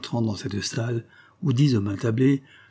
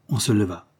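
plain reading voice

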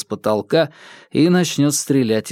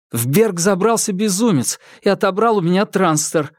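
blurted out; 7 semitones higher than usual. A narrator's reading. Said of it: "выпалил Марк".

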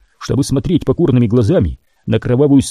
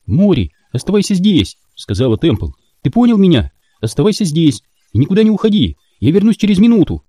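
put-on higher, firm young woman; Temple character voice; 7 semitones higher than usual. A narrator's reading. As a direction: tell him firmly to stay.

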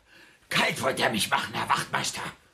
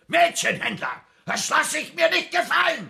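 laut und empört schreien; empörtes Schreien; 13 semitones higher, 4 dB louder than usual. Acted. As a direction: schreit außer sich vor Wut. Empörter Streit vor Polizei. (empört brüllend)

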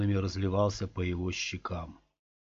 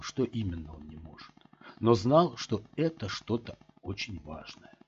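usual speaking voice

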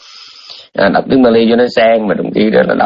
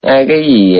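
ordinary talk

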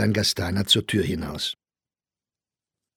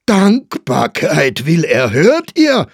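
slow, stretched cry climbing very high; outraged shout; 7 semitones higher, 10 dB louder, slower than usual. A narrator's reading. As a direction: shout it to everyone in disbelief. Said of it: "schrie Staufner". (empört gedehntes Schreien)

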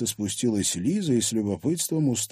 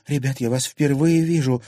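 louder, higher insistence; insistent repeated denial; 5 semitones higher, 5 dB louder than usual. Acted. Girl repeating denial to angry thugs, insistent. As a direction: repeat it more firmly to them. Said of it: "повторила Лиза".

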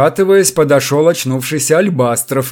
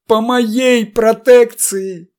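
much higher, slow, strained admission; anguished confession; 10 semitones higher, 4 dB louder, much slower than usual. A narrator's reading. Chertoslepov admitting guilt to the captain, anguished strain. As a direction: confess it as if it tears you apart. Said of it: "с надрывом признался Чертослепов".

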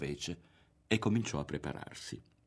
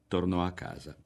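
plain reading voice

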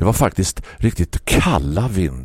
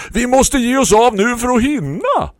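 much higher, louder urgent calling neighbour; eager neighbour; 4 dB louder than usual. Acted. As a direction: press him to hurry, calling out.

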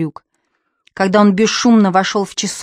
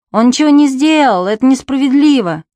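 indignant protest; 5 semitones higher, 5 dB louder than usual. Acted. Boy protests unfair punishment.

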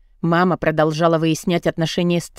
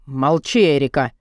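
sharp shout; 5 semitones lower than usual. A narrator's reading. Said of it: "прикрикнул на меня отец".

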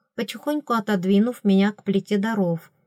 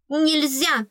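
higher, sharp exclamation; queen cries out; 7 semitones higher than usual. A narrator's reading. Fairy queen forbids the girl's resistance, sharp alarmed cry. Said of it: "вскричала королева".

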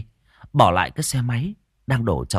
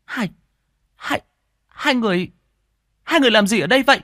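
stammering in high, loud outrage; Siêu, outraged; 12 semitones higher, 4 dB louder, faster than usual. A narrator's reading. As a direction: sputter the question in shocked fury. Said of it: "Đôi mắt của cô Siêu trợn lên, mũi thở phì phò". (tức giận lắp bắp, giọng the thé)

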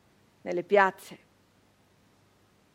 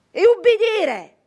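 bitter outburst; 11 semitones higher, 15 dB louder than usual. A narrator's reading. Forced obedience.

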